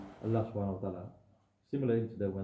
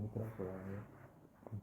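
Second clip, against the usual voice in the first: much softer, slow, a smug offer; quiet smug repeat; 12 dB quieter, slower than usual. Retold or imitated. Bob softly, slowly gloats as he offers his spare fish. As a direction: let the repeat drop to a slow, soft gloat.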